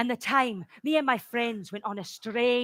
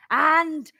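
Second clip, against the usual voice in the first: usual speaking voice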